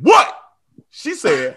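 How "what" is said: high, loud startled yelp; startled what. Retold or imitated. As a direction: jump at being called. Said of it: "I said".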